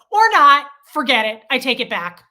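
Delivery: loud burst on the first words, then firm; re-enacted snappy retort; indignant takeback. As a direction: take the apology back in loud annoyance. Acts snapping at an unfriendly stranger.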